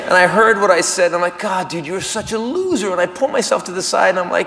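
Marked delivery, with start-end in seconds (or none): 1.4-2.9 soft, under-the-breath self-scolding; muttered self-reproach. Acted. Comic berates himself after embarrassing remark, muttered self-reproach. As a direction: mutter it privately to yourself.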